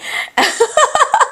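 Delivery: a high rising giggle; high laugh. Real, not acted. Vlogger laughing at needing her friend, high giggle.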